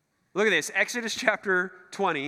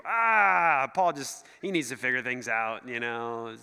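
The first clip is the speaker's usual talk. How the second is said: dismissive shrug; 7 semitones lower, slower than usual. Retold or imitated. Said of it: "those are shruggers to you".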